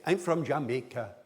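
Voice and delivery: syllable-timed Jamaican English; Jamaican accent. Shown as a demonstration. Lecturer showing how Jamaicans really say it, Jamaican accent. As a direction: say it Jamaican, every syllable evenly punched. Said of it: "They say".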